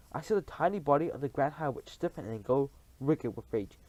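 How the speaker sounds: plain reading voice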